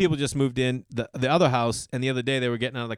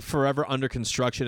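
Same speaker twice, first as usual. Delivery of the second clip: ordinary delivery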